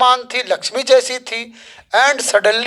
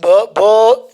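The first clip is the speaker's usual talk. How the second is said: acted stammer on one syllable; halting, demonstrated stutter; 3 dB louder, much slower than usual. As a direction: stumble on the first sound, unable to finish. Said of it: "she could stammer".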